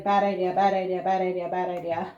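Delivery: a lightly voiced anxious mutter; fretful chant while bouncing; nervous repeated muttering. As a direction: repeat it in growing worry. Rick bouncing, panicking.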